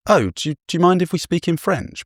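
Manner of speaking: high-pitched, tentative retold question; impulsive polite request. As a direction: lightly act out a sudden, hesitant request. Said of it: "this little part of my brain, for some reason, said".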